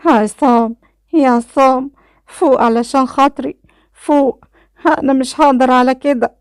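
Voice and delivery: high, pleading distressed woman's voice; tearful crying out. A narrator's reading. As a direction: plead desperately with him to wake up. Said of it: "كانت ليلى بتعيط وبتصرخ جنبي". (صوت عالي باكي ومترجّي)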